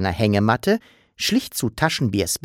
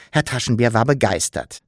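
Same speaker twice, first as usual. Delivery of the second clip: ordinary delivery, nothing marked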